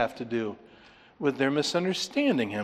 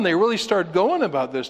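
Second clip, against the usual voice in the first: ordinary talk, nothing marked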